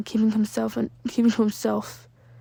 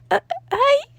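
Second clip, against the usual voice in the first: much higher, louder nervous squeak; 6 dB louder than usual. Acted. Midoriya nervously greets Ida with a high squeak. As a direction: squeak out a startled hello. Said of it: "Midoriya squeaked".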